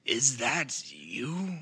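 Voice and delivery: very slow, halting, feeble question; ailing Uncle Popcorn. Acted. Game master as ailing uncle recognising Boudreaux, feeble. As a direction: drag out each word as if barely conscious. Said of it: "you can tell he's not doing good".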